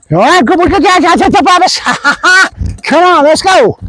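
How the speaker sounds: high-pitched, gushing praise; high, playful cheering with a laugh; excited dog praise. Real, not acted. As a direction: gush over the dog in a high voice. Trainer cheering dog at end.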